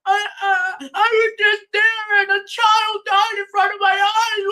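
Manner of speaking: high, whiny fake sobbing plea; mock crying. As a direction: imitate a hypocrite tearfully pleading with God. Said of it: "why you crying to God saying".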